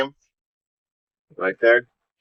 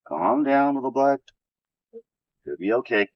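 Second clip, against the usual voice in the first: soothing; 3 dB quieter than usual. Real, not acted. Calming an animal, soft voice.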